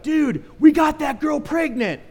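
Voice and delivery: high, loud, slow blurted shout; excited shout. Retold or imitated. Man bursting out with the pregnancy news, shouting high. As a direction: yell the news, thrilled.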